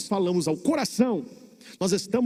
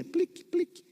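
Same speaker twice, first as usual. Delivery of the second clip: high little water-drip plinks; imitated dripping; 12 semitones higher, 3 dB quieter than usual. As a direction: mimic a leak with tiny high clicks. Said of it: "Aí você escuta assim".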